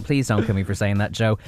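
ordinary talk, nothing marked

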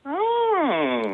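high, drawn-out surprised oh; realising something, voice rising high. Real, not acted. Realises the E is gone.

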